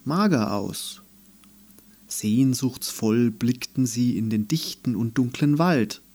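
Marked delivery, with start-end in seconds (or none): none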